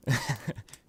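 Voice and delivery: brief laugh at own joke; small amused laugh; short chuckle. Acted. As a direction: chuckle lightly to yourself.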